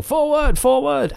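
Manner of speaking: high shouted order, forward; shouted command. Retold or imitated. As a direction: yell forward like an officer. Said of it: "they've got the guy saying".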